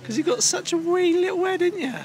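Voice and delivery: very high, cooing tease; teasing baby talk. Pretend. Teasing girlfriend about her little head, high babyish voice.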